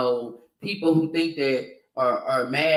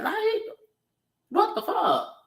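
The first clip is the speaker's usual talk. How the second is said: shrieked high in disbelief; outraged disbelief; slower than usual. Retold or imitated. Replaying her reaction to a commenter, squealed high disbelief. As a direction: fling it up high, floored by the comment. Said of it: "it really triggered me because I was like".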